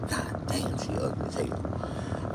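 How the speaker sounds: whispered, slow aside; hushed aside. Retold or imitated. Telling the audience about her looks, hushed whisper. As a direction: whisper it like a secret insult.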